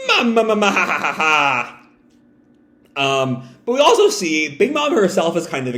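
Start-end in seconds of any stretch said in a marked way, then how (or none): 0.1-3.2 villain cackle. Retold or imitated. Big Mom's signature laugh.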